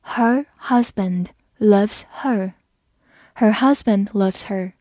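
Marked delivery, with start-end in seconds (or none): 0.0-2.5 slow reading with pauses between words; slow read-along. Shown as a demonstration. Teacher modelling the second sentence, slow and separated. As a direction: say each word separately and slowly. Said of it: "好，来慢读一遍".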